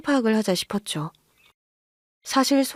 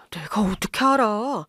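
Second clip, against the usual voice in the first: whispered protest. Acted. Husband protests under his breath.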